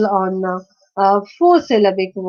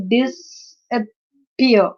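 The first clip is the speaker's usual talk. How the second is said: "disappear" slowly as dis-ap-pear; syllable by syllable; much slower than usual. Shown as a demonstration. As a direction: break "disappear" into three parts. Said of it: "this is a three-syllabic word".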